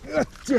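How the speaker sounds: effort grunt. Real, not acted. Heaving while catching fish.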